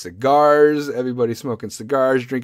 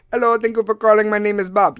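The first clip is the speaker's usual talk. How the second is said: put-on loud, higher-pitched Indian call-centre accent; thick Indian accent; 8 semitones higher, 6 dB louder than usual. Call-centre agent greeting a caller, thick Indian accent. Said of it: "has the most thickest ass accent".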